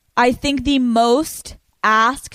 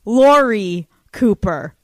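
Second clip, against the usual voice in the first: higher, big announcer-style build; hyped guest introduction; 5 semitones higher than usual. Real, not acted. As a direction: announce the guest grandly, slowing on the name. Host announcing her guest.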